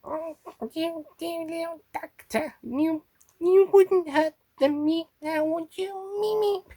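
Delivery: high, put-on creature voice; Miriam Margolyes and Toby Longworth blend. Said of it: "trying to do a blend of Miriam Margolyes and Toby Longworth".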